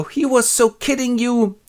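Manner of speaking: high, sing-song taunt; mocking sisters. Bingley sisters taunting Jane, high sing-song. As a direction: tease her cruelly. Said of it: "all go".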